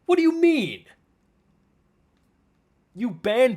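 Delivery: angry outburst, pitch climbing high; furious shout. Real, not acted. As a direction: blow up at him in real anger. Furious rant at streamer.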